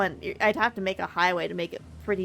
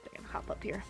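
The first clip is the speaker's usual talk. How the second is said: whispering; 12 dB quieter than usual. Real, not acted. Sneaking up while playing.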